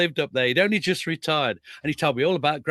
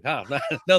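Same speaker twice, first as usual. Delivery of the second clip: usual speaking voice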